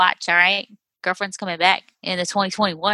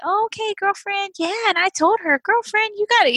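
put-on higher-pitched grandma saying girlfriend; affectionate mock-grandma voice; imitating grandma; 10 semitones higher than usual. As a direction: imitate grandma chatting in a raised voice. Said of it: "listening to her talk like that".

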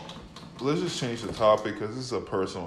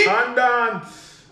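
mock echo; 11 semitones higher, 6 dB louder, much slower than usual. Mimicking host's word.

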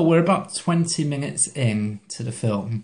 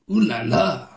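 cheesy put-on French ooh la la; over-the-top French accent; hammy French accent; 3 semitones higher than usual. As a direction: ham up a French tourist cliché. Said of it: "hammy French accent".

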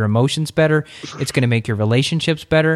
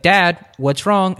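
put-on higher, slow little child voice; toddler voice; 7 semitones higher, 3 dB louder, slower than usual. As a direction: ask like a worried little kid. Voicing his toddler daughter.

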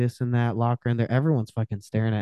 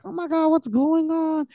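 much higher, shrill-free falsetto of an alarmed woman; shocked bystander woman. Retold or imitated. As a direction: squeak it like a startled onlooker. Bystander woman reacts to fight.

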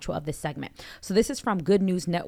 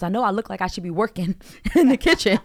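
louder, laughing through the punchline; laughing quote; 3 semitones higher, 7 dB louder than usual. Retold or imitated. Retelling a comic's opener, laughing loudly. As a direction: quote the joke while cracking up. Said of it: "She goes".

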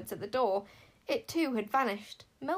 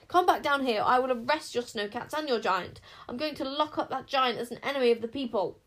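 loud, higher commanding shout; angry yell; 4 semitones higher, 6 dB louder than usual. A narrator's reading. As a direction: bellow orders upward. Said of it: "Queen Sycorax yelled".

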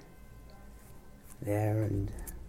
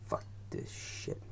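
resigned; 8 dB quieter than usual. Retold or imitated. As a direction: mutter it under the breath, utterly done. Suicidal man's resigned line.

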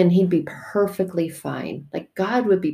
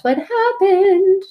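"happened" stretched out high; teasing sing-song; 13 semitones higher, 3 dB louder, much slower than usual. Real, not acted. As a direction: dangle the payoff, slow and rising. Teasing the story's payoff.

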